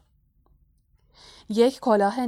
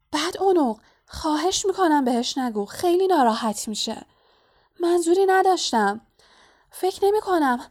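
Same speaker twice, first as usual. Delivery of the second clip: high-pitched anxious pleading; worried; 8 semitones higher, 3 dB louder than usual. A narrator's reading. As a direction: plead urgently, voice raised in pitch. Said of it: "هرمایونی با نگرانی گفت". (با نگرانی و التماس، با صدای زیر)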